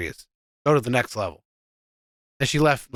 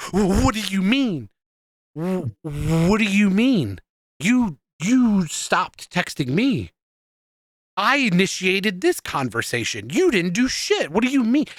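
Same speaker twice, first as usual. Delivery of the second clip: retold frustrated outburst; 6 semitones higher than usual. Ranting to cohost about girlfriend's text, retelling his rising outrage. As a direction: build from stunned to exasperated, pitch rising to the end. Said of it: "I'm still pissed off about it".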